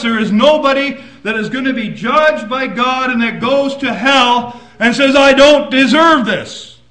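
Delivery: loud, driving preacher's voice; fiery preaching. Acted. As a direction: proclaim it to the church. Preaching at full force.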